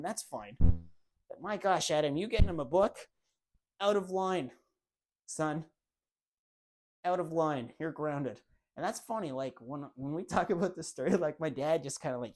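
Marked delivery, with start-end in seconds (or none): 0.6-8.4 a hint of an outraged father, louder and slow at first; mock scolding dad. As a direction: ham up the dad's outrage. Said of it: "no issue with my brother".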